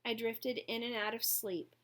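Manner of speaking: plain reading voice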